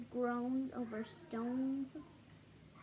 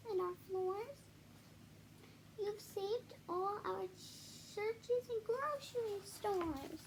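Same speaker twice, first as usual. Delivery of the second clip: higher, small grateful voice of the speck; tiny speck voice; 7 semitones higher than usual. Acted. Tiny mayor thanking Horton in a small high voice. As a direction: play the tiny mayor, thankful and earnest, slowing at the end. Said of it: "came the voice".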